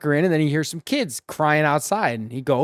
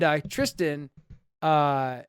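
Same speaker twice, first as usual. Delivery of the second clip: ordinary talk, nothing marked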